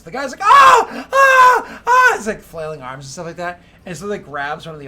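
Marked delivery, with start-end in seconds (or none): 0.0-2.1 high, very loud repeated screams; terrified screams. Acted. Victim screams as the creature grabs him. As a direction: scream in terror.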